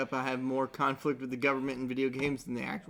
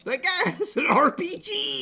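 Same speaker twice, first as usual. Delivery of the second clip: excited; 4 dB louder than usual. Real, not acted. Excited pointing.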